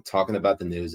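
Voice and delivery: ordinary talk, nothing marked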